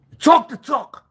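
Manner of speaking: loud, high call of talk the talk; shouted challenge. Acted. Taunting a rival, loud shout. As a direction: bellow it like a taunt.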